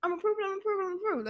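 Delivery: high, thrilled repeating of the news; giddy high-pitched joy; excited squeal. Retold or imitated. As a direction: squeal it like you just got the call. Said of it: "And I'm like".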